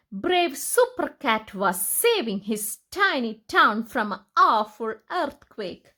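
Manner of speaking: plain reading voice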